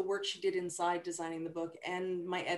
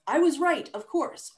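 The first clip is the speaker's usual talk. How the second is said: higher, louder smug I-was-right; triumphant exclamation; 8 semitones higher, 8 dB louder than usual. Shown as a demonstration. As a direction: crow it like you knew all along. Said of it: "which means".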